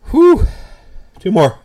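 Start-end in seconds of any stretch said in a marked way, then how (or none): 0.1-0.5 sharp high whew from the heat; pained exhale. Real, not acted. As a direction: blow out hard against the burn. Eating spicy nut challenge.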